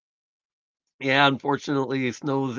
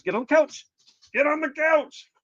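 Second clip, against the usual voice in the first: much higher, sing-song command to the pups; dog-talk voice; 13 semitones higher than usual. Real, not acted. Owner telling his dogs to hop on the couch, high sing-song. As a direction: coax the dogs up in a high pet voice.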